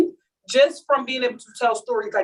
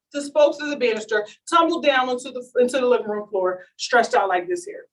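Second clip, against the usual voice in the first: normal talking voice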